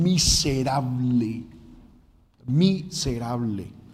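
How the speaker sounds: "miserable" stretched out very slowly; énfasis en miserable. Real, not acted. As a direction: draw out each syllable of "miserable". Repeats the word for weight. ("miserable" lento, sílaba por sílaba)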